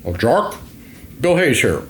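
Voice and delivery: put-on voice of an older priest boss; Father Hayes. Priest boss phoning his worker, in an affectionate impression. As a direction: imitate the boss announcing himself by phone. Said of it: "Father Hayes im-, impression".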